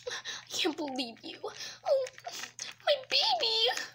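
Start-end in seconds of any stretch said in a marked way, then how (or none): 1.4-3.8 high, anguished cry of worry; distraught. Acted. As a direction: wail it like a frantic mother. Mother distraught over child.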